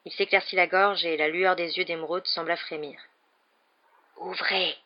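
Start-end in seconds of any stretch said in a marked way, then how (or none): 4.2-4.8 a soft, hoarse serpent hiss. A narrator's reading. Harry speaks Parseltongue to the carved snakes, hissing. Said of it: "dans un sifflement rauque".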